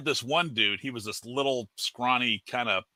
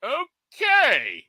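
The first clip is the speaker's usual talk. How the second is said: awkward okay; 4 dB louder than usual. Retold or imitated. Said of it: "the whole flight was just like".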